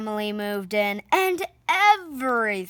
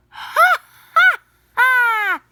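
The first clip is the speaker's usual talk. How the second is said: big, much higher, louder party laugh; 12 semitones higher, 10 dB louder, slower than usual. Acted. The Louds laughing at their party, loud high laugh. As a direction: let out a roaring ha ha ha. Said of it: "and laughed".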